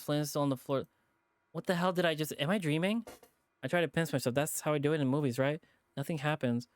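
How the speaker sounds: ordinary delivery, nothing marked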